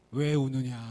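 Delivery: mountain god. Acted. Mountain god questioning the crying man, loud and low. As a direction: boom down at the weeping man.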